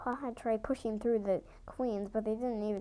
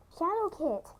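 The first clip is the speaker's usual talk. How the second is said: high, loud call of a name; yelled; 8 semitones higher, 4 dB louder than usual. A narrator's reading. Littermates yelling to wake Shadowkit. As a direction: shout to wake her. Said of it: "they both yelled".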